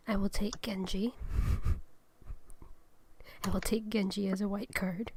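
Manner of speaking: whispered card reading; whispering. Acted. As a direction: whisper it like a secret.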